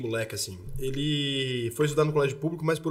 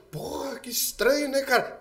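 puzzled Fábio; 10 semitones higher, slower than usual. Retold or imitated. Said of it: "E aí o Fábio".

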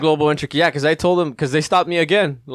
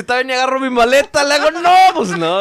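excited retelling; 11 semitones higher, 8 dB louder, faster than usual. Retold or imitated.

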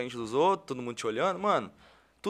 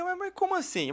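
much higher, mock puzzled; confused surprise. Host replies to kids mocking his car, puzzled high voice. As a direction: play baffled at the kids' teasing. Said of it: "Eu falei".

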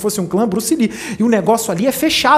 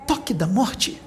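ominous hush; 2 semitones lower, 9 dB quieter, slower than usual. Retold or imitated. Ominous naming of the technique.

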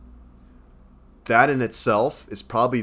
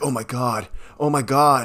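mock panic, climbing higher and louder on the repeat; Ellie panicking; 4 semitones higher, 4 dB louder than usual. Podcaster retelling a frightened game scene, acted panic. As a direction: imitate a mildly panicked, fearful exclamation. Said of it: "Ellie's panicking in".

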